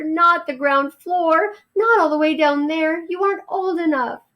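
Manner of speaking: anxious mother mouse. Acted. Mother mouse forbids Ralph the ground floor, high and worried. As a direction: hold him back, fretting. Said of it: "cried his mother".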